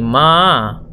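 ordinary talk, nothing marked

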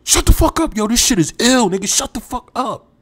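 high put-on voice snapping to shut up; snapping at friends. Friend with headphones snapping at teasing friends, high and irritated. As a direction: bark at them like he's annoyed to be interrupted.

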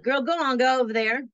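high, loud brush-off of a brash guard; a guard barking at the new inmate. Retold or imitated. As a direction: wave her off like a bossy guard. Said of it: "she was like".

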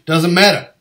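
emphatic mock-impatient order; loud insistence. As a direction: cut in loudly and insist. Retold insistent coaching reply.

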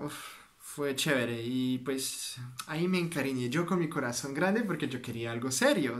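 ordinary talk, nothing marked

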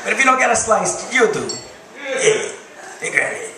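New York bear. Acted. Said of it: "Like a bear from New York".

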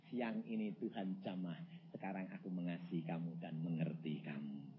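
very soft, low and sincere; tender confession. Retold or imitated. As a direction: confess love to the wife, hushed and deep. Husband's tender confession to wife.

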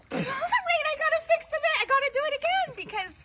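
high, fast, flustered in the cold; high excited. Real, not acted. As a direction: rush it out, still shocked. Still shocked in ice water.

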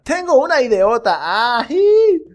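high, loud eureka; hammed-up eager hero; excited idea. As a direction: burst out with the plan. Hero announces plan.

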